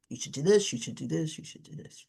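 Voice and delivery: repeated nagging that fades away; mock badgering, dwindling to very soft; rote advice-giving. Acts out repetitive nagging advice.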